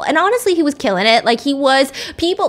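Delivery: ordinary talk, nothing marked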